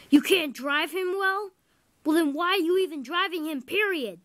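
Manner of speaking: an engine's boss scolding a bad driver; angry outburst. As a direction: fire the questions at him, outraged. Furious after the derailment.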